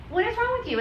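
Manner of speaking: high mocking taunt of the accuser. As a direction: pitch it way up like a sneering know-it-all. Also not over us saying.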